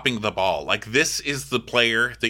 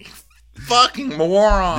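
slow, higher-pitched jab; exasperated insult; 9 semitones higher, 4 dB louder, slower than usual. Pretend. Host fed up with the castmate's reply, exasperated. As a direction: stretch it out in exasperation.

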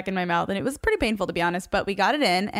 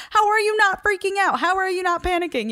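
much higher, louder mock disbelief; incredulous; 10 semitones higher, 4 dB louder than usual. Asking her calm husband why he isn't panicking, high incredulous squeal. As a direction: squeal it at someone who won't panic.

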